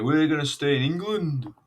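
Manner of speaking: high-pitched, badly faked Lancashire accent. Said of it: "I can't do a Lancashire accent".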